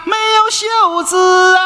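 hawker's cry. Acted. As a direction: shout the wares out. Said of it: "shopkeeper continues the call".

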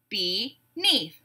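"Beneath" split in two, be-neath; two separate beats on "Beneath" with a gap; syllable by syllable. Shown as a demonstration. Teacher breaking a word into syllables, beat by beat. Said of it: "we'll break it into syllables".

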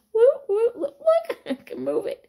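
very high, loud, giddy whooping; delighted that the arm moves again; excited squeal. Real, not acted. Teacher showing viewers her healed arm, squealing with delight.

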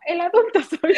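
half-laughing self-reminder; laughing mantra. Retold or imitated. Mother laughing at her own mantra, laughing.

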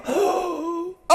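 sharp, high worried gasp; mock gasp. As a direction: gasp as if the bills just hit you. Voicing a worried patient.

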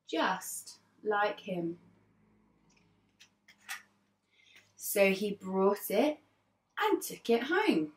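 put-on lively read-aloud for young children; teacher reading a picture book to her class; storybook narration. A narrator's reading. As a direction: tell it like a class story time.

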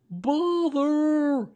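high, loud, drawn-out cry of frustration; exasperated trapped bear, pitched well up; Winnie the Pooh stuck in a jar. A narrator's reading. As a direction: call out crossly from inside a jar. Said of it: "he said inside the jar".

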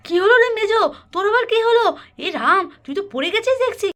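high, loud startled outcry; acted alarm. Elder finds sister-in-law fallen off ladder, high alarmed outcry. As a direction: exclaim in alarm, pitched well up.

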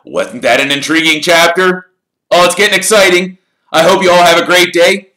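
excited. Real, not acted. Reader's cheerful sign-off.

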